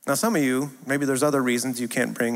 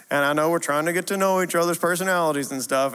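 usual speaking voice

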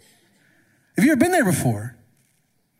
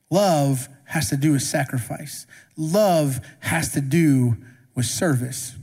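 slow emphasis; 4 semitones lower, slower than usual. Acted. Preaching key point.

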